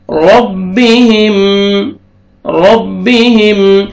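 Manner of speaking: ربهم with a full heavy r and doubled m; slow ربهم, the ra thick, meem merged. Shown as a demonstration. Teacher demonstrating the heavy ra in ربهم. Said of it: "را کو موٹا کر کے پڑھیں گے".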